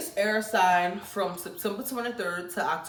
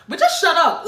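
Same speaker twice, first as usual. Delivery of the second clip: much higher and louder; fed-up shout; 9 semitones higher, 10 dB louder than usual. Acted. Telling Virgos to shut up, a loud shout. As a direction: blurt it out at them.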